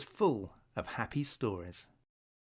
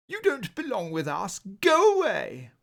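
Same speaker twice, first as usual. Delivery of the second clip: high, rising haughty dismissal; haughty owl; 7 semitones higher, 5 dB louder than usual. Acted. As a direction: shoo him off, louder on go away. Snobbish owl rejects newcomer.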